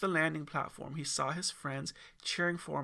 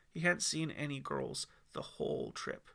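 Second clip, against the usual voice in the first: plain reading voice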